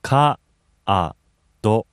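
Ka-a-do stretched syllable by syllable, long ka; over enunciating. Shown as a demonstration. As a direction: say Ka-a-do slowly with each syllable apart. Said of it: "Break it down".

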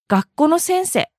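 学校の先生 with each final vowel cut short; 学校の先生 with severed endings; Kansai clipped endings. Shown as a demonstration. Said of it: "最後の言葉を省略する".

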